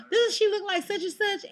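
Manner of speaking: high, loud mocking question; squeaky mock-excited imitation; mimicked coworker. Mocks the comparison once more.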